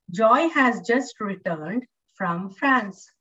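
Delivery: slow and deliberate, every word clear; slow reading. Shown as a demonstration. Teacher reads example sentence slowly to class. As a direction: read it out slowly for practice. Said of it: "try to say this word".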